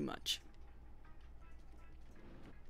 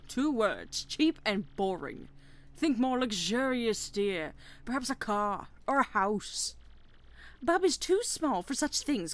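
bickering posh parent; 5 semitones higher, 4 dB louder than usual. Acted. Bob-omb parents argue.

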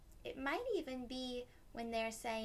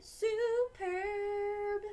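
high, loud, sung-out cheer; delighted; 10 semitones higher, 6 dB louder than usual. Acted. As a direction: sing it out gleefully. Friends agree.

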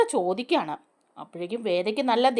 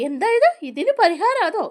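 much higher, louder disbelief; shocked outburst; 8 semitones higher, 4 dB louder than usual. Retold or imitated. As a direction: blurt it out in shock. Vikram shocked by the temple demand.

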